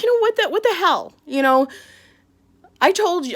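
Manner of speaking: high, loud exasperated outburst; venting at the nurse in disbelief. Retold or imitated. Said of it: "I was like".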